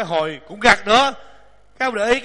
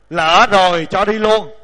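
loud, slow, drawn-out punchline; mock-solemn verse ending; 6 dB louder, slower than usual. Teacher capping a solemn verse with a joke, slow mock-solemn intoning. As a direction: deliver it in the same weighty recitation.

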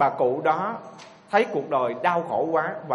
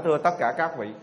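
ordinary delivery

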